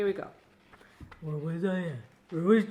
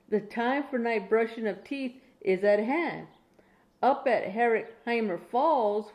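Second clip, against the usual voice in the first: steady, clear bedtime story reading, a bit slower; reading to a child; 6 dB louder, slower than usual. Acted. Grandma reading a Dr Seuss bedtime book to her grandchild, clear storytelling. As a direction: read it out like a bedtime book for a little one.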